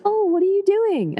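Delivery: very high, drawn-out question; mock friendly curiosity. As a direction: imitate a harmless, curious onlooker, slow and high. Voices gentle onlooker questioning a woman.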